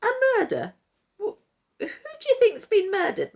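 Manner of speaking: high, loud, incredulous scoff; mocking disbelief. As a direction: laugh off her alarm. Puppet master mocks woman.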